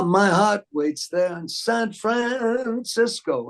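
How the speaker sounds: slow, high belted line in [name]'s style; [name] impression. Singer does [name] while Merv plays [name]. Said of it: "I just turned right into [name]".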